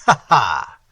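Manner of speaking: loud mocking ha-ha; scornful laugh. A narrator's reading. The prince mocking the stranger's warning, loud scornful laugh. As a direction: laugh the threat off with contempt. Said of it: "laughing scornfully".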